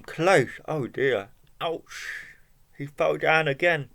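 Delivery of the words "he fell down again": ordinary delivery, nothing marked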